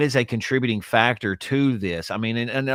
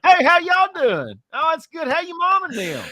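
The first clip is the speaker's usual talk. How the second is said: hammed-up Southern hello, pitched way up; Southern accent; 6 dB louder than usual. Shown as a demonstration. Showing off the accent to a co-host, exaggerated Southern accent. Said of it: "I'll put on my Southern accent".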